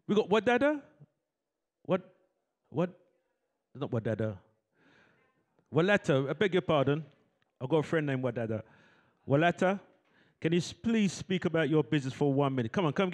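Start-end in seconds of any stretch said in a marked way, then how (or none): none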